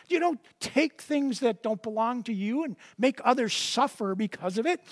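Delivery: ordinary talk, nothing marked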